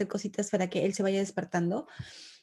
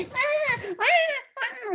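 mock wailing. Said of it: "esté como que lamentándose".

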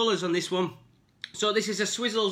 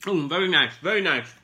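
talking while chewing sweets; words round a mouthful; mouth full; 2 semitones lower than usual. Real, not acted. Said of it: "I can't talk when I'm eating".

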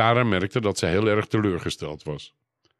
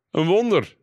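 high, exclaimed announcement; calling out; 10 semitones higher, 3 dB louder than usual. A narrator's reading. Brother announces a miracle to the table, calling out. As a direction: proclaim it in a raised voice. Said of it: "riep Peer nu".

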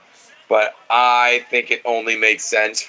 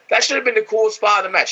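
exasperated; 11 semitones higher, faster than usual. Real, not acted. Complaining about missed spot.

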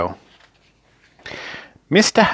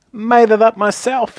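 high-pitched, coy little boast; modest; 11 semitones higher than usual. A narrator's reading. Mister Funny about his own latest joke, modestly. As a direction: add it with mock modesty. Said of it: "he added modestly".

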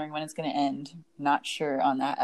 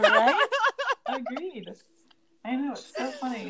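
laughing; 8 semitones higher than usual. Real, not acted. Laughing agreement.

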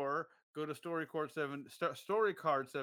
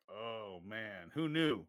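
dazed and hypnotized; 8 semitones lower, 4 dB quieter, slower than usual. Retold or imitated. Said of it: "We're like".

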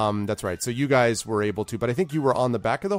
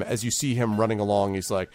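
ordinary talk, nothing marked

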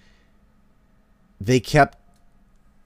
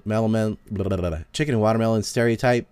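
usual speaking voice

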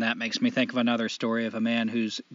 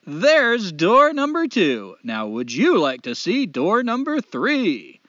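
higher, louder, slow showman devil; 6 semitones higher, 5 dB louder, slower than usual. Acted. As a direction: greet him like a grinning host of hell. Devil voiced.

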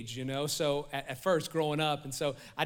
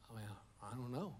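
low, much softer trailing shrug; mock vague mumbled reply; clueless mumble; 8 semitones lower, 17 dB quieter, much faster than usual. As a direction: mumble like someone with no reason. Aimless person answering shrug.

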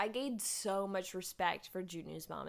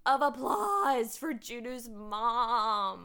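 louder, slow, stretched-out acclaim; rousing cheer; 2 semitones higher, 5 dB louder, much slower than usual. Real, not acted. Co-host hailing the mom after her speech, rousing cheer. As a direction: draw it out like calling for applause.

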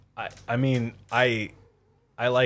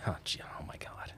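whispered, quick oh my God; whispered aside; 8 semitones lower, 18 dB quieter, faster than usual. Real, not acted. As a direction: breathe it out in frustration.

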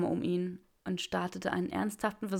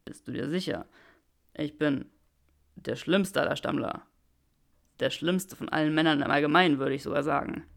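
lower, self-mocking man; Draco's voice; 4 semitones lower, 5 dB louder than usual. Draco asks Granger if she is sure, wry. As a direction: undercut yourself wryly, lower.

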